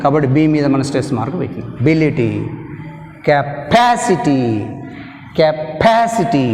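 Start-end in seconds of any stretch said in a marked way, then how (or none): 3.3-6.5 Capacity stretched out with stress on pa; slow model word. Shown as a demonstration. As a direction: say Capacity slowly, leaning on pa. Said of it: "ఎక్కడైతే stress mark ఉందో".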